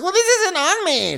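very high whiny voice; mock Lindsay. Co-host mocks Lindsay dodging blame, very high whine. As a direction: squeak out the denial.

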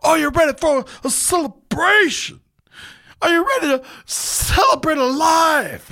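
big hype-man pep rally yell. Retold or imitated. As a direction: fire up the crowd like a pep rally. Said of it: "just pep rallying up".

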